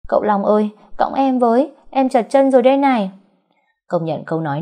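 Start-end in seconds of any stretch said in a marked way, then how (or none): 0.1-3.1 high, loud whiny wail; loud high pleading wail; wailing call. Acted. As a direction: wail after him to be carried. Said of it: "gào ầm ĩ".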